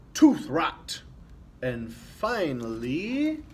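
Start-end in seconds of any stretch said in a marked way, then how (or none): 0.1-1.0 loud pirate complaint; grumpy pirate. A narrator's reading. Pirate refusing the candy canes, loud and grumpy. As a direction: bark it like a sour old pirate. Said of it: "He'll say".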